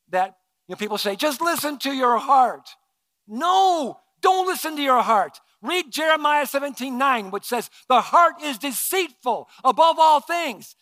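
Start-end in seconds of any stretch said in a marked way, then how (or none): 1.2-10.8 louder, higher, driving preacher voice; full-force preaching. Acted. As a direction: preach it out to the whole room.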